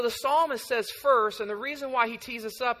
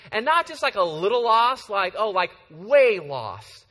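excited emphasis; 8 dB louder than usual. Real, not acted. Pastor telling the congregation about the hike, excited. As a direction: punch how lost they got. Excited story of getting lost.

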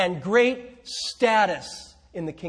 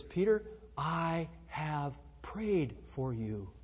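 soft, slow and tender, much quieter; tender hush; 6 semitones lower, 13 dB quieter, slower than usual. Acted. Jesus reassuring Peter before his denial, soft and slow. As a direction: speak it gently, like a whispered comfort.

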